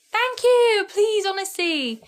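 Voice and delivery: gushing thanks. Real, not acted. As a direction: thank the viewer with delight. Thanking viewer again.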